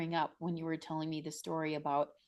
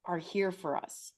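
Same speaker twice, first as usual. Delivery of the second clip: usual speaking voice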